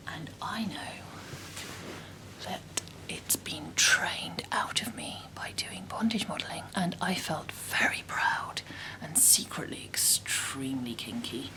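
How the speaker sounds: conspiratorial whisper. Real, not acted. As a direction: whisper it like a naughty secret. Secretly proud confession.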